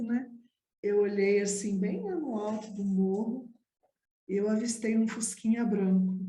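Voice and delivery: normal talking voice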